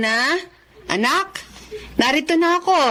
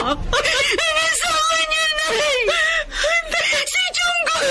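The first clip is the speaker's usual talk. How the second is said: higher, sobbing furious shouts; crying angry outburst; 6 semitones higher than usual. Acted. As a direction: rage through tears at him. Daughter accuses stepfather, weeping. (galit na umiiyak na sigaw)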